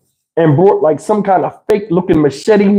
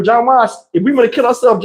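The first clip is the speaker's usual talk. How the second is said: usual speaking voice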